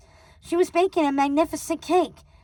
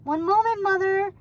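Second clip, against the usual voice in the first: calling out; 7 semitones higher than usual. A narrator's reading. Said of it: "called the princess".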